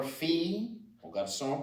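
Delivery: normal talking voice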